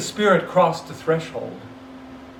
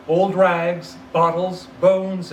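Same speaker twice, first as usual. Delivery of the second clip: usual speaking voice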